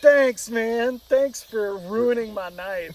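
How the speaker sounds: loud bitter thanks, slowing right down; hammed-up gratitude, dragging out the complaint; sarcastic thanks. Replaying his answer to the girlfriend, loud sarcasm. Sarcastic reply in retold breakup.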